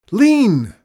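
high, very loud command; shouting. A narrator's reading. Chester ordering frozen Mister Bond to shift, shouting. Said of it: "Chester shouted again".

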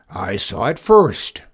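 slow, loud roar. A narrator's reading. Farmer insists on his claim to the moon, roaring. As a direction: roar it out slowly, staking a claim. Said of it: "roared Zeke".

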